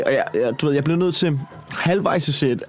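normal talking voice

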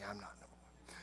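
low, hushed whisper, nervous to be noticed; whispered denial. Retold or imitated. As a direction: breathe it out under the voice.

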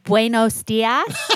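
put-on slow, laughing Buenos dias in a flat American accent.

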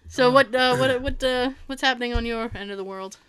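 usual speaking voice

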